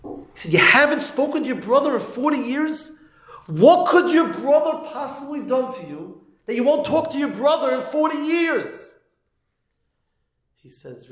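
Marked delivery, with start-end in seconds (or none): 0.5-2.9 much higher, rising disbelieving question; acted incredulity. Rabbi to estranged mourner who shunned his brother, acted incredulity. 3.5-8.9 much higher, rising disbelieving question; acted incredulity. Rabbi to estranged mourner who shunned his brother, acted incredulity.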